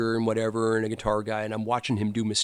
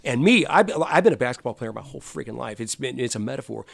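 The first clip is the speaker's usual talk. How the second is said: usual speaking voice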